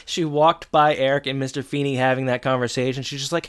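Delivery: ordinary delivery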